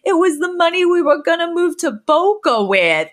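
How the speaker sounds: high, whiny mother complaining about lost money; hammed-up wail of an old mom; distressed mother. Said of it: "Sylvia goes".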